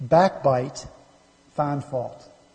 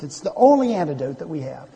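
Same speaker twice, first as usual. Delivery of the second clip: ordinary talk, nothing marked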